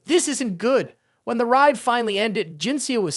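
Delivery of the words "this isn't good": ordinary delivery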